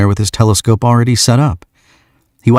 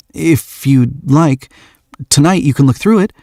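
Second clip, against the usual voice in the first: higher, halting then hurried offer; nervous; 8 semitones higher than usual. Acted. Shy young man invites her to stargaze, nervous. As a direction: stumble into the invitation. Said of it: "he says nervously".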